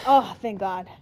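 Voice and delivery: usual speaking voice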